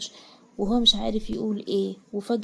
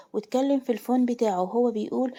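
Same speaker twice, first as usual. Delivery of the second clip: usual speaking voice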